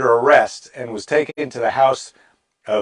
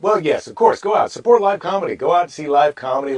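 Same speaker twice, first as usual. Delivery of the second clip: higher-pitched hammed-up plug; mock promotion; 5 semitones higher, 4 dB louder than usual. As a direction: sell it with put-on enthusiasm. Mock plug reversing his advice.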